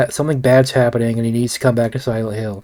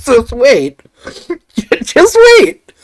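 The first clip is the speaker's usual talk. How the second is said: laughing; 10 semitones higher, 4 dB louder, slower than usual. Real, not acted. Laughing at own teaser.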